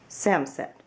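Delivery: ordinary delivery, nothing marked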